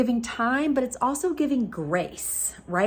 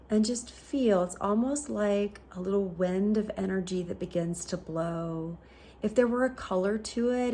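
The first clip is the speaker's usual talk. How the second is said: guided meditation; 5 dB quieter than usual. Acted. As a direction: guide them inward unhurriedly, with long pauses.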